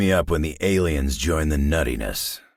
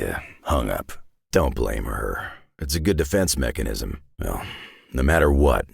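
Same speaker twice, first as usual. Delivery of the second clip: usual speaking voice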